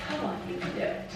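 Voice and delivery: fast whisper to herself; whispered self-encouragement. Real, not acted. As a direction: whisper under your breath, willing it to work. Coaxing flowers into a vase.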